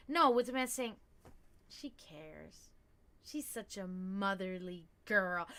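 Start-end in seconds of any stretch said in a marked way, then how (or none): 1.7-5.5 soft, low and drawn out; murmured low, with affection; tender approval. Acted. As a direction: imitate a man quietly admiring her. Man voiced speaking approvingly of her.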